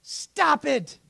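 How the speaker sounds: full shouted rebuke; harder rebuke. Acted. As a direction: slam the command down this time. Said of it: "pastor repeats rebuke harder".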